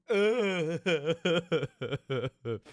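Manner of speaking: pained laughing groan. Real, not acted. Laughing through sour pain.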